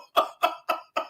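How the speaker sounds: breathy, voiceless chuckle; quiet laugh after his own joke. Real, not acted. Laughs at own imagined quote.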